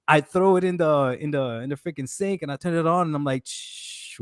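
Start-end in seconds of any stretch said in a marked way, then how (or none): 3.5-4.2 soft hushing sound of a tap; whispered "shh" for rushing water; imitated running water. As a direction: mimic water hissing from a faucet.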